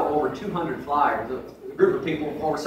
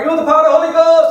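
shouted high, hammed-up revival preacher; mock revival preacher; 11 semitones higher, 9 dB louder, faster than usual. Imitating an African revival preacher calling out to thousands. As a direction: bellow it like a preacher whipping up a crowd.

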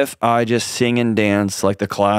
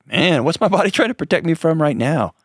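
high-pitched wondering self-talk; curiosity; 7 semitones higher than usual. Acted. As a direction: wonder aloud with open, non-judging curiosity. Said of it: "It's a posture of curiosity".

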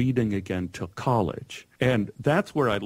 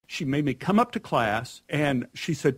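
normal talking voice

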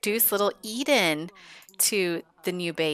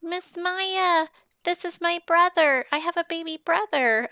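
put-on much higher, sing-song little-kid voice; toddler voice; 10 semitones higher than usual. Toddler Eden shows Maya her baby brother, high child voice. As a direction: squeak it out like a proud little sister. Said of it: "she's like".

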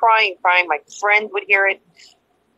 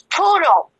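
higher-pitched imitation of his hello; lightly mocked caller, pitched up; ex's phone greeting; 7 semitones higher, 4 dB louder than usual. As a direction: mimic his sudden pet-name greeting.

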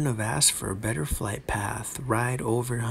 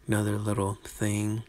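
ordinary talk

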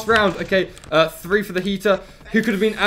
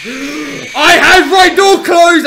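high, loud, slow shocked shout; gasping outcry; 9 semitones higher, 11 dB louder, much slower than usual. Real, not acted. Player sure the door was shut, loud shocked cry. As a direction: gasp and protest in disbelief.

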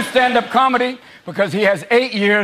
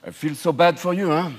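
a lightly put-on French sympathy, softer; soft, sneering French condolence; mock French; 4 semitones lower, 4 dB quieter than usual. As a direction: fake pity with a hint of French. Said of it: "the French were going".

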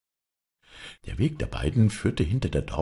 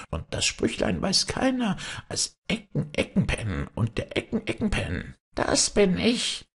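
high, squawky, gloating goblin; gleeful high kobold squawk; 11 semitones higher than usual. A narrator's reading. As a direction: crow it in a reedy goblin squawk. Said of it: "schrie dabei mit seiner Quäkstimme".

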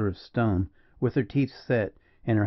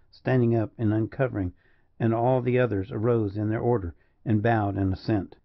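plain reading voice

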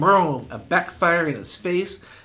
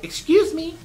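mock prissy girl; 13 semitones higher than usual. Mocking Millie Butler's voice.

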